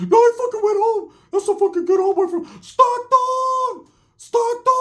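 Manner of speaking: very high, squeaky put-on voice; cartoonish high mock voice; Ricky's voice. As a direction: squeak it out like a whiny cartoon con. Voicing Ricky again.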